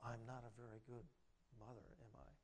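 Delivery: soft, low, insinuating whisper of doubt; enemy's suggestion. Retold or imitated. The enemy whispering doubt into Linda's ear, soft and low. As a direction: plant the doubt quietly and low. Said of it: "he's suggesting to Linda".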